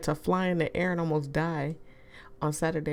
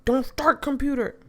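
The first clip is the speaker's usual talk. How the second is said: mock scolding computer; 8 semitones higher, 6 dB louder than usual. Real, not acted. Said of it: "Oh my God".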